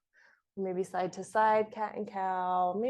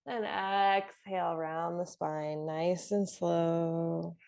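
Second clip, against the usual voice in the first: drawn-out, unhurried breathing cue; slow yoga cue; 4 semitones lower, slower than usual. Real, not acted. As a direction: stretch the words to slow the movement. Cueing a slowed yoga movement.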